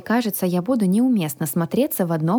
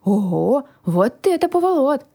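amazed dragon voice, rising high; wowed character voice climbing in pitch; excited dragon; 7 semitones higher than usual. A narrator's reading. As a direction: marvel at the discovery as the dragon. Delighted dragon discovers the room.

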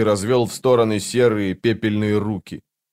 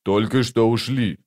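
usual speaking voice

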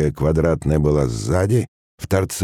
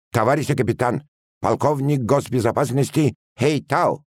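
lightly voiced Chinese accent Russian, higher; Russian words with a Chinese accent; 10 semitones higher than usual. A narrator's reading. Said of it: "заговорил балабол по-русски с китайским акцентом".

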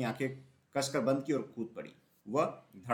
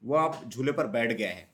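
ordinary delivery

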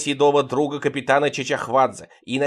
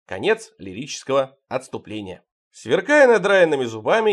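plain reading voice

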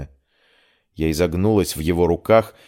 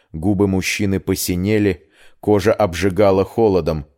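ordinary delivery, nothing marked

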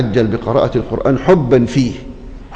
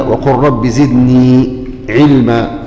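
slow recitation; 3 semitones lower, much slower than usual. Acted. Recites verse.

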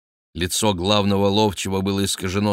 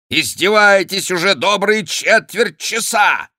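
high, loud angry exclamation; outraged cry; 12 semitones higher, 6 dB louder than usual. A narrator's reading. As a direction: shout it in indignation. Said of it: "вскричал, побледнев граф". (возмущённо вскричать)

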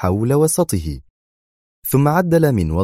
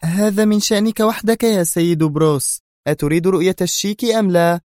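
sharp woman; 12 semitones higher than usual. A narrator's reading. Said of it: "قاطعته في حدة".

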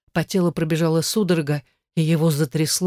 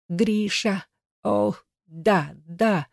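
climax cry; 4 semitones higher, much slower than usual. A narrator's reading. Heroine climaxing.

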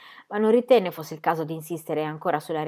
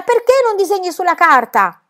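high, loud scolding question; impatient teacher crying out; exasperated shout; 12 semitones higher, 9 dB louder than usual. Acted. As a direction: demand it at the end of your patience. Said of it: "gridò la maestra spazientita".

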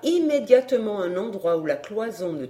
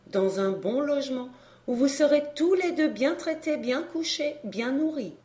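lightly voiced young lady, higher pitch; kindly young lady, promising; 5 semitones higher, 3 dB quieter than usual. A narrator's reading. Young lady promising the boy lodging, lightly voiced higher. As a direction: lift the voice lightly for her promise.

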